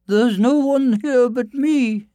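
very high, slow, loud woman's voice; high, drawn-out storybook wife; giant's wife. Acted. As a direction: reassure the giant in a high-pitched voice. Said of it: "said his wife".

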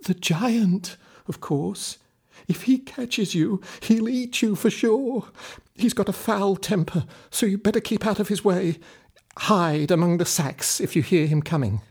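put-on high old woman's voice, urgent warning; fretful old woman. A narrator's reading. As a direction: warn the boy about the giant, high and worried. Old woman explains the giant.

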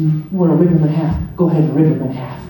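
ordinary talk

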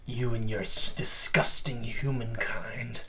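slow, contemptuous whisper; whispered disgust. Acted. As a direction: hiss the insult under your breath. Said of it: "And he says".

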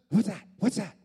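put-on loud, high kid's questions; urgent, startled child voice; startled little boy. Boy to his seatmate as plane moves, startled kid. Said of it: "there's this little boy".

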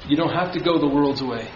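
ordinary delivery

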